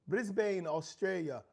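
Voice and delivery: put-on Australian accent. Real, not acted. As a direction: slip briefly into an Aussie accent. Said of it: "I put that accent on".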